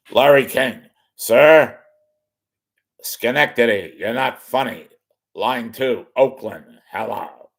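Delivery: loud, high call-in host impression; Larry King. Host taking calls on line two, Larry King impression. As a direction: bark it like a talk host taking callers. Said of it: "It's my Larry King".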